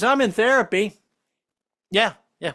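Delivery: normal talking voice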